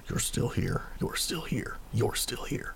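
breathy, whispered muttering under his breath. Acted. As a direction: mutter it to himself, over and over. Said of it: "muttering something".

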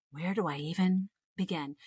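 ordinary delivery

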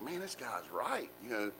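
realizing the coworker was right, quiet and slow; inner thought. Retold or imitated. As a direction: say it under your breath, slowly.

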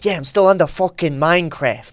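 a hint of a New York Italian gangster; Goodfellas gangster. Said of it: "You said it like a Goodfellas".

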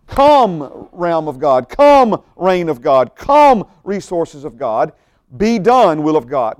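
emphatic decree, verbs leaping out; commanding call. Shown as a demonstration. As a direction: hammer every "Come" and "Be done" as an order. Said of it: "we're calling for the kingdom".

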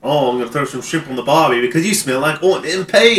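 high, loud, fast Australian parody; mock Australian accent. Co-host riffing on a cartoon insult, loud mock Australian accent. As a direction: holler it in hammed-up Australian.

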